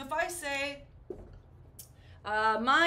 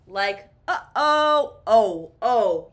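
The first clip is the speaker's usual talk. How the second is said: mock panic; 12 dB louder, slower than usual. Panic about owing government.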